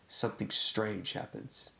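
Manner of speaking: usual speaking voice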